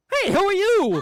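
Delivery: high, loud startled question; husband finds a stranger with his wife. Acted. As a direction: jump at the stranger in the bathroom.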